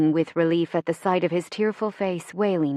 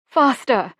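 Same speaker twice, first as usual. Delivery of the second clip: high, loud urgent cry; shouted; 6 semitones higher, 6 dB louder than usual. A narrator's reading. Running for the shelter under bombs, shouted. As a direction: shout it to hurry them on. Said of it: "Scarlett shouted".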